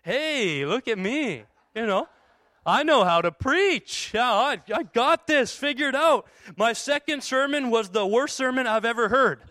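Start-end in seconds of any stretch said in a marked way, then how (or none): 0.0-6.3 cocky. Retold or imitated. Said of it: "I left there feeling like".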